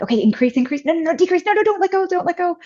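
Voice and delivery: high, rapid urgent orders, climbing in pitch; urgent coaching. Retold or imitated. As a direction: re-enact frantic instructions mid-contraction. Urgent orders during a contraction, retold.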